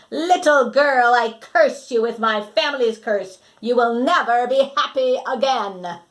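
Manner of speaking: hammed-up villain cursing; shouted curse. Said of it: "and shouted".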